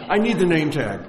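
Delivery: loud mock demand from a miffed salesman; insistent salesman. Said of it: "he says".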